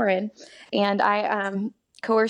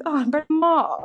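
a hint of an old film star, pitched up; lightly imitating a grand old actress; bad Katharine Hepburn; 6 semitones higher, slower than usual. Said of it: "my bad Katharine Hepburn accent".

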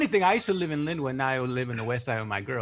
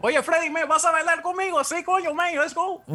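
put-on Cuban accent; 13 semitones higher than usual. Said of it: "They're like".

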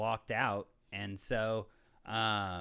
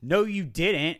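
a firm, raised retold no; retold denial; 6 semitones higher, 6 dB louder, slower than usual. Stranger at the store insisting he never apologized, firm retold denial. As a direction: push back with a curt denial, a touch louder. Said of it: "he immediately got aggressive and yelled".

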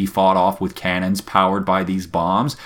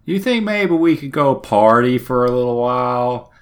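higher, wheedling sailor voice; pleading pirate crew; 5 semitones higher than usual. Retold or imitated. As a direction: coax the captain for shore leave. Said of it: "they're like".

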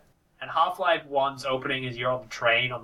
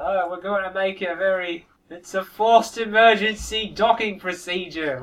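higher-pitched, hammed-up British voice; imitation British accent; 6 semitones higher, 5 dB louder, slower than usual. Quoting an emergency docking in Portal two, mock British accent. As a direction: put on a British accent for the announcement. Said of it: "it's that kind of British".

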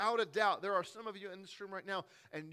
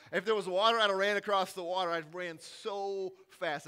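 laughing; 3 semitones higher, 11 dB louder than usual. Real, not acted.

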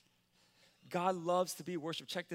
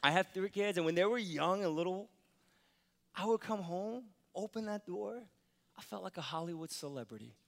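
normal talking voice

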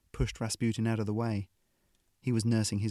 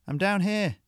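louder, higher call from below; shouted; 10 semitones higher, 5 dB louder than usual. A narrator's reading. Ariadne calling up to Sarah on the gantry, shouting. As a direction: call up to be found. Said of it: "Ariadne shouted".

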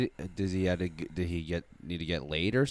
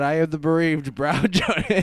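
laughing; 6 semitones higher, 7 dB louder than usual. Real, not acted. Laughing grand title.

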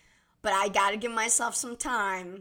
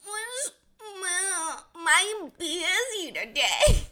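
mocking; 9 semitones higher, much slower than usual. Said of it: "you were like".

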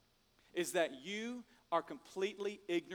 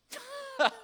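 high mock giggle; 5 dB louder than usual. Mock laugh in role.

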